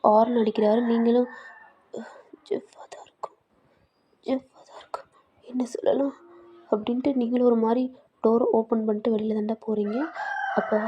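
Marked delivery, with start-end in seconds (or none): 2.5-6.1 whisper to herself. Acted. Muttering to herself about Jeff.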